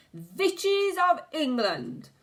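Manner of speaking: slow, high, loud call to the hall; witch's shout. A narrator's reading. The Grand High Witch calls the room to order, shouting. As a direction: summon the crowd with a witch's shriek. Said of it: "shouted the Grand High Witch".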